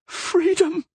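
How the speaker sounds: very high, wild yell of joy; crazed joy. A narrator's reading. Prisoner yelling in crazed happiness, very high. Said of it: "in a fit of crazy happiness".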